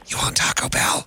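whispered question in character; acted whisper. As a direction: whisper it like a hushed little request. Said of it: "do our best to act these out".

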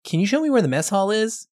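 high, faux-naive question; mock innocent. Kaffee toying with the witness, mock innocent. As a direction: play dumb with a high lilt.

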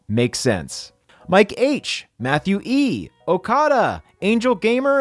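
ordinary talk, nothing marked

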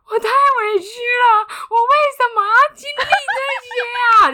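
high, loud, hammed-up sobbing complaint; crying wail. To her partner after the storm, high loud hammed-up sobbing. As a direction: wail it like a wronged child. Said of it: "然后下一秒我就大哭".

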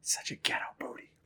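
muttered under the breath in a whisper; a jealous aside, whispered. Retold or imitated. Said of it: "She's like".

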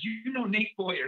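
second take, stunned and stammering; stunned repeat. Retold or imitated. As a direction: stumble over "You, you" in shock. Driver repeats, even more amazed.

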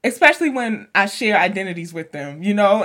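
usual speaking voice

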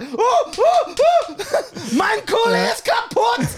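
very high, laughing German line; overjoyed Chris. Retold or imitated. Friend finally using his one German sentence, ecstatic and laughing. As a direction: squeal it with pure delight. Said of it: "Chris was like".

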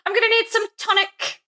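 very high mock British, giggling; squeaky put-on British through laughter; fake British accent. Cohost playing an ailing Victorian needing tonic, fake British accent. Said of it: "like a fake British accent on the podcast".

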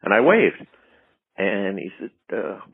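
ordinary delivery

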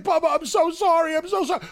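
high, pleading mock apology; imitating Natty apologizing. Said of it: "She's like".

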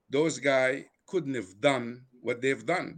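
ordinary talk

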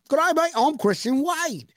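high, hammed-up Aussie accent, lightly done; mock Aussie accent. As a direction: play a cheery Australian bloke.

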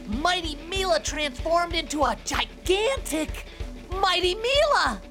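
high, awestruck outburst, peaking on the last name; thrilled sidekick gasping out the news; amazed excitement. Acted. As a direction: marvel aloud at a giant hero. Kid amazed at giant transformation.

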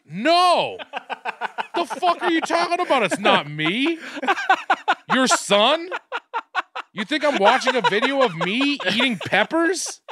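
high-pitched mock indignation; retold incredulous protest. Son retelling his answer to mom, incredulous. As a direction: protest in hammed-up disbelief, voice shooting way up. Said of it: "And I'm like".

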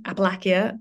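Appalachia with a short "lack" and ending "kia"; trial pronunciation. Shown as a demonstration. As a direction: try Appalachia as "appa-LACK-ia", questioning. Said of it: "how do you pronounce that?".

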